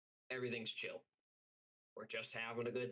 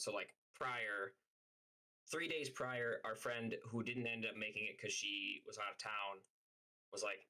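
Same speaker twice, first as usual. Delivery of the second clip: ordinary talk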